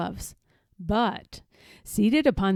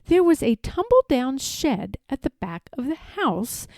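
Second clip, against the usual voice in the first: plain reading voice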